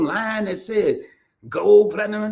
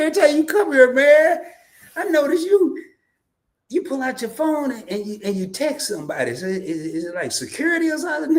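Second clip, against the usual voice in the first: voicing a curious friend, a little higher; quoting friend; 7 semitones higher than usual. Retelling a friend's nosy question at the store, higher voice. Retold friend asking about phone.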